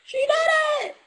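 mock amazed high-pitched cheer; amazed squeal. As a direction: squeal it like astonished onlookers. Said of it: "people are gonna be like".

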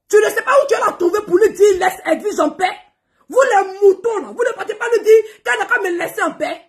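high, fast, loud tirade with laughs; loud scolding. Real, not acted. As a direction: rant at the crowd. Scolding the "moutons.

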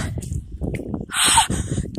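shrill shocked breath in; high gasp from cold. Real, not acted. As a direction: gasp as icy water rises. Sinking deeper in cold water.

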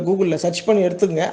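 usual speaking voice

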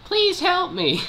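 squeaky high little mushroom voice; Mario Kart 64 Toad voice. Acted. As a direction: pitch way up like a tiny cartoon mushroom. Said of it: "the Mario Kart sixty-four Toad voice".

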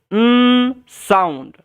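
"উম" as a long held nnn, then "sound"; N sound. Shown as a demonstration. Teacher showing the sound for pronouncing N, held nnn. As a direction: hold the bare consonant n in "উম", no vowel. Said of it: "করবেন আপনারা".

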